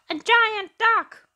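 high, loud, slow duckling shriek; a startled little duckling quacks it out; quacking duckling. Acted. Duckling spotting Percy, high loud quacking alarm. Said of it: "one duckling quacked".

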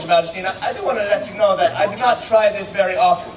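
ordinary delivery

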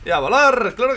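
loud, high burst; big punchy gamer yell; triumphant shout. Real, not acted. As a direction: belt it out as the enemy goes flying. Calling out a kill in game.

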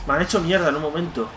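ordinary talk, nothing marked

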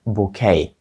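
bouquet said as "boo-KAY"; French-style silent t in bouquet; silent ending. Shown as a demonstration. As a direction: end bouquet on "ay", with no t. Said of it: "закінчення в кінці у французькій мові глухе".

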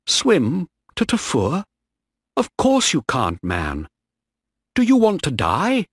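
high, alarmed disbelief with wide pitch swoops; incredulous. Acted. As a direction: question it as if it's madness. Shipmate reacts to escape plan.

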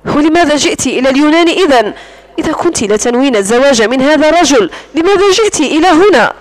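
plain reading voice

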